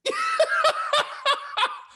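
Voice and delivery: loud high cackling laugh; high burst of laughter. Real, not acted. Host cracking up over the old lady joke, loud high laugh.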